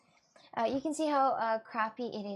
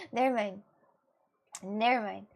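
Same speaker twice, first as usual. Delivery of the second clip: ordinary delivery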